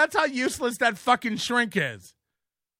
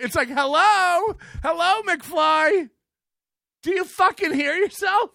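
exasperated yell; 9 semitones higher, 5 dB louder than usual. Real, not acted. Streamer yelling at Kevin about his own words, exasperated and loud. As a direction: hammer each word like he can't believe it.

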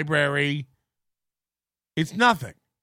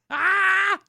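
high startled mock cry; hammed-up little scream; mock yelp; 13 semitones higher than usual. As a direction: yelp in fake alarm.

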